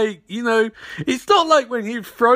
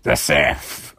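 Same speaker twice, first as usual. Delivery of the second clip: much lower, mock sinister villain; Palpatine voice. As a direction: drop way down for an evil emperor. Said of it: "And Palpatine's like".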